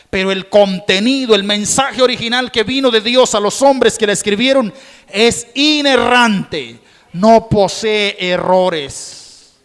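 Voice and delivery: loud high fervent preaching; impassioned pulpit sermon; preaching at full force. Acted. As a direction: pour it out to the congregation, full volume.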